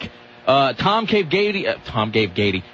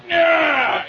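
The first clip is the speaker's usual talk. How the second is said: mock triumphant yell; 12 semitones higher than usual. Host playing a TV exec cramming shows in, mock yell. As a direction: whoop it like shoving a drawer shut. Acting out TV exec stuffing drawer.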